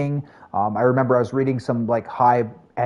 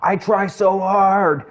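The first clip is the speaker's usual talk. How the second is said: slow, higher whine of frustration; annoyed; 7 semitones higher, much slower than usual. Retold or imitated. Streamer mimicking his annoyed self to chat, drawn-out whine. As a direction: drag it out like you're fed up. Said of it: "I'm so annoyed because I'm like".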